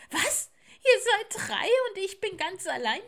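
very high, loud owlet howl; loud wailing. Acted. Little owl is alone against three visitors, loud wailing. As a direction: wail in outraged childish protest. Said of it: "heulte das Eulenkind noch lauter".

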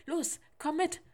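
high, loud urging; urgent, eager. A narrator's reading. Manuel pulling Sebastian to his room, eager urging. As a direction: hurry him along, high and loud.

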